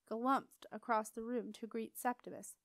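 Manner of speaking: ordinary delivery, nothing marked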